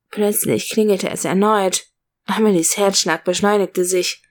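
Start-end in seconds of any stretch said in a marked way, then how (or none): none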